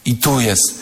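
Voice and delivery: usual speaking voice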